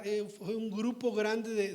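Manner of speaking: ordinary talk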